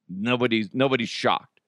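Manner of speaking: usual speaking voice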